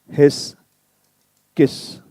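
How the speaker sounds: ordinary talk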